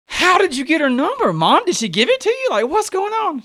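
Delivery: high-pitched, retold disbelief; incredulous questioning. Son quizzing his mom about the number, incredulous. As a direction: demand answers, voice swooping up high.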